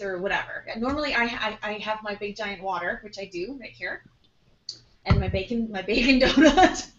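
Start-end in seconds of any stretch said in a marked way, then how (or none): 5.7-6.9 laughing mid-word; giggling through the words. Real, not acted. Laughing over her bacon donut.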